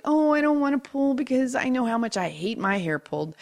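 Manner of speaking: lightly hammed-up motherly concern; mothering worry. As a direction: retell it as a fussing, reluctant mother. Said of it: "going back to the mothering part".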